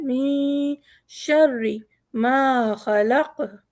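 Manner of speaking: शर्री with a thin doubled रा; light ra. Shown as a demonstration. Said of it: "रा बारीक है". (रा बारीक पढ़ें)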